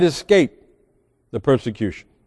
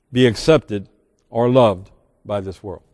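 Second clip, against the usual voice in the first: ordinary talk, nothing marked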